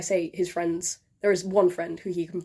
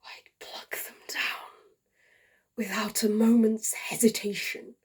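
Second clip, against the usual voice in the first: tragic love letter. Acted. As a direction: read it like a dying man's farewell. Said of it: "performed by yours truly".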